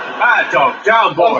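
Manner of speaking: firm mock command to a dog; scolding a dog. Man orders his dog off the couch, scolding.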